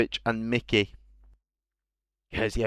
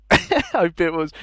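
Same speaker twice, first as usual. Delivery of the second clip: laughing; 7 semitones higher, 4 dB louder than usual. Real, not acted. Laughs mid restart of line.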